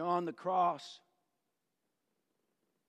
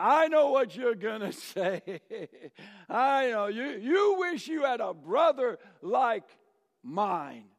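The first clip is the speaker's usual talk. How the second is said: smug interruption; 6 semitones higher, 7 dB louder than usual. Acted. College boy cutting in smugly.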